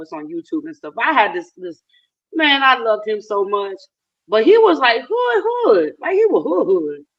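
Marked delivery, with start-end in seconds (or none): 4.4-7.1 excited. Real, not acted. Excited praise of a coworker.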